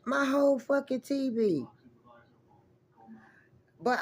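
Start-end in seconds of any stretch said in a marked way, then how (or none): 0.0-1.6 high, exclaiming in disbelief; real dismay. Real, not acted. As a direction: cry out at the loss. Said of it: "I was heartbroken then".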